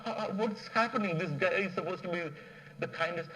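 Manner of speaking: high, baffled exclaiming; surprised soldiers. Retold or imitated. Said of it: "these guys are surprised".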